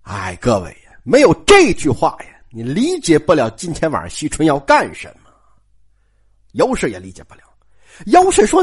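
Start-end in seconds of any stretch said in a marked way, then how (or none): none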